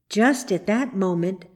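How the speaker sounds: plain reading voice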